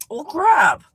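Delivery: high, loud "well, crap"; exclaiming. Real, not acted. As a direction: blurt it out in surprise. Sudden realisation mid-show.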